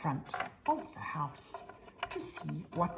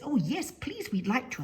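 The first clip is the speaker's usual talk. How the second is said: eager cub; 7 semitones higher than usual. Acted. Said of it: "And Benny says".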